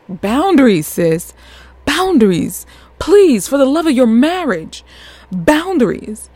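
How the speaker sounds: high, emphatic begging; exasperated plea. Acted. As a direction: plead with a friend to see sense, pitch swooping up. Mock plea to the film's heroine.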